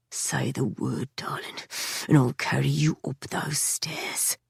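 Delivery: slow, soft whisper at her ear; hushed flirting whisper; seductive whisper. A narrator's reading. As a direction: tease her, low and slow. Leaning close, mouth over her ear.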